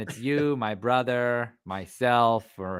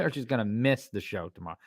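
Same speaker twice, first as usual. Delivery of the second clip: ordinary talk, nothing marked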